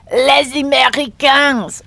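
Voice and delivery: "les Américains" said slowly in French, with a liaison "lez-Américains"; slow down and lock in on "les Américains" in French; careful French. Shown as a demonstration. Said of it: "I had to lock in for that".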